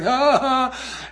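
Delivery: high, nervous fake laugh; mock panicked laugh. Josh being introduced to girls, mock nervous laugh. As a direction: imitate a kid freezing up around girls. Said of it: "he's having some kind of seizure".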